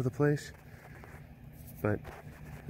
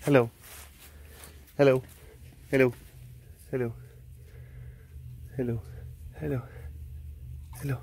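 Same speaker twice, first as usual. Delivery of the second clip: usual speaking voice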